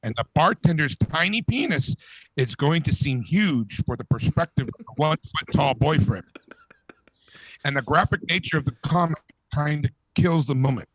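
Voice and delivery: ordinary talk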